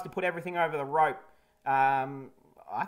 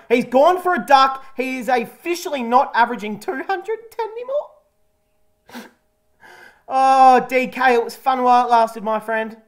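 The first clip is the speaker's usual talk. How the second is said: mock grief outburst; 5 semitones higher, 9 dB louder than usual. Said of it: "I just get a bit emotional".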